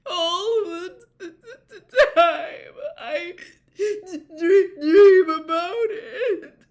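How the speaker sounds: high, slow, sob-broken stutter; sobbing stammer. A narrator's reading. Missus Weasley confesses her nightmares, sobbing and stammering. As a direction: choke each word out through tears.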